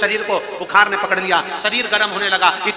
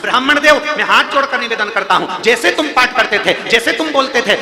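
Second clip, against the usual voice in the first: loud, higher fervent sermon voice; full-force preaching; 5 semitones higher, 6 dB louder than usual. Acted. Preacher exhorting the congregation on true devotion, fervently. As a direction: preach it out to the whole crowd.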